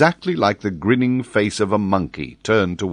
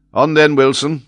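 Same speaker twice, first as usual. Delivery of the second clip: captain's order; 7 semitones higher, 6 dB louder than usual. A narrator's reading.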